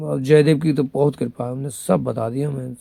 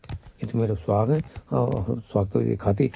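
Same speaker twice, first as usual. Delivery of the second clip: ordinary delivery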